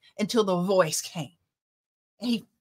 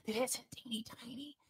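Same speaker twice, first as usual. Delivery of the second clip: put-on teeny-tiny whispered voice; demonstrated whisper; 19 dB quieter, faster than usual. Shown as a demonstration. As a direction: drop into a hushed whisper. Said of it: "And it's not whispering".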